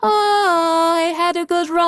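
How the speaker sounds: high, slow, faint farewell; mock dying sigh. Joking about turning to dust, slow high dying voice. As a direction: play it as a fading last breath. Imitating herself turned to dust.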